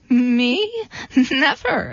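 mildly indignant goat, pitch lifting; understated proud protest; proud exclamation. A narrator's reading. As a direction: exclaim it with a touch of pride. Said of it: "exclaimed the goat proudly".

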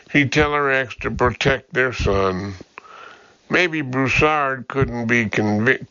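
ordinary delivery, nothing marked

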